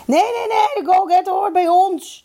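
very high, protesting yell; shouting. A narrator's reading. The third team's captain claims the goalgetter, shouting high. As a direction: shout to claim him for your side. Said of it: "schreeuwde Van Zanten".

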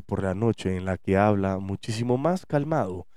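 a lightly done calm, deep DJ tone; late-night DJ calm. Shown as a demonstration. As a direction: ease down like a night radio host. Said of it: "Chris utiliza esta voz como la voz del D J de la radio".

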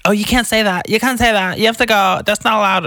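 high, loud mock scolding; scolding busybodies. Skate park people telling off a kid, mock scolding. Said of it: "these people are like".

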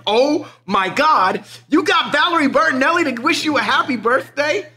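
high, loud, stretched amazement; amazed parents. Retold or imitated. Parents shocked at the birthday video, high and excited. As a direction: gush like stunned parents. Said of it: "The parents were like".

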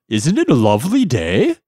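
high, loud wavering jellyfish; wobbly floating jellyfish. Acted. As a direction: call out sweetly as a jellyfish. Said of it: "he raised his voice and said".

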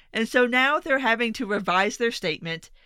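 ordinary talk, nothing marked

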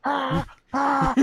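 very high woman-scream wail; mock car alarm scream. Imitating the parrot's car-alarm scream, very high wail. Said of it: "making a car alarm".

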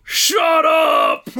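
high-pitched mock shout; imitated yell back. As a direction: yell it back like an angry heckler. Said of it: "another guy yelled at the guy".

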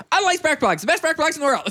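like a vintage spark plug ad; 1930s radio voice. Pretend. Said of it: "that your nineteen thirties voice?".

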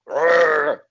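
a hammed-up angry growl; imitating a wrestler's roar. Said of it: "the question mark will go".

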